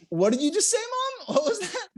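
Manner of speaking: high-pitched, laughing disbelief; hammed-up scandalized question to mom; mock shock. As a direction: squeal it like you can't believe your ears. Acts shocked reply to mother.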